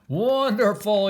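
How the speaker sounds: king marvelling loudly; delighted cry. Acted. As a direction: cry out in wonder at the juggling lion. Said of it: "he cried".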